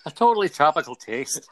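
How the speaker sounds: put-on a laughing, light Northern Irish accent on request; half-laughing Northern Irish accent, done for a friend; Tommy Wright's accent. Asked by his co-host to do a Northern Irish accent, laughing. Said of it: "say totally tropical taste in Tommy Wright's accent".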